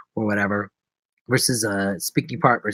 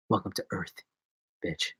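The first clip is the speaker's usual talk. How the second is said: lower, softer flat line reading; flat, understated delivery; 6 semitones lower, 10 dB quieter than usual. Shown as a demonstration. Showing the flat movie delivery, low and soft, no energy. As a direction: throw it away with no emotion. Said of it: "Just kinda go".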